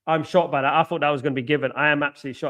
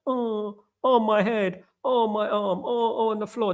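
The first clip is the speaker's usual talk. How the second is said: high, mock-pained moaning; mock injured whining; 7 semitones higher, slower than usual. Mocking footballers rolling on the floor, high pained whine. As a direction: whimper like a player faking injury. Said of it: "like weak little snowflakes".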